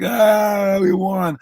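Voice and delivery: high, drawn-out happy wail; hammed-up tearful joy, stretched high; mock winners' cry. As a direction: wail it out like a champion overcome by victory. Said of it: "both teams are crying. The winners are like".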